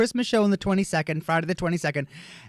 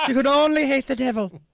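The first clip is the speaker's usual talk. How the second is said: put-on a light Irish accent on the nun's line, a touch higher; 7 semitones higher than usual. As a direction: imitate the nun's mock Irish lilt. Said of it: "She just used that accent".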